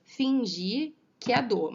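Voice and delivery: "Fingir que é dor" slowly stretched into its syllables. Shown as a demonstration. Teacher dividing the verse for students, syllable by syllable. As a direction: draw out each beat of "Fingir que é dor". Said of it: "vamos contar essa divisão deles?".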